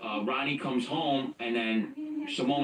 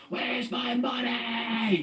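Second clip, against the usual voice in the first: retold screaming; 10 semitones higher, much slower than usual. Ronnie screaming at the man who shorted him, high drawn-out mock scream. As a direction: drag it out high like a scream, without real volume. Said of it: "I remember Ronnie screaming".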